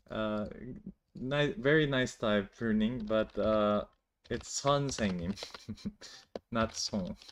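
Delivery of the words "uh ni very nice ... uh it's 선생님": ordinary talk, nothing marked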